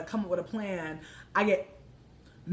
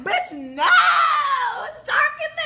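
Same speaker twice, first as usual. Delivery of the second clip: screeching retort; 8 dB louder than usual. Retold or imitated. Speaker yells back at the woman from the car, screeching retort. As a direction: screech back in hammed-up outrage.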